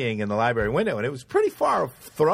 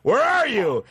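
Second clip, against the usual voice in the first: high, demanding father's question; acted parental alarm; dad on phone; 10 semitones higher, slower than usual. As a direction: imitate a dad calling out for his son. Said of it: "I hear Dad on the other side".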